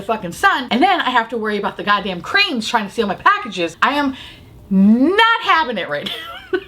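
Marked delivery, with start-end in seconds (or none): 4.7-6.6 high laughing protest, slow; laughing mad. Real, not acted. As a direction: laugh through mock fury. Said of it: "Mama's mad".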